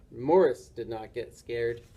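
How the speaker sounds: plain reading voice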